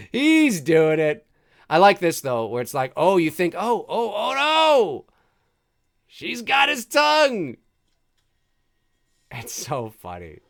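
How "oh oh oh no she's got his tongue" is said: high hammed-up alarm; mock panic. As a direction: squeal it in mock horror. Voicing a panicked viewer.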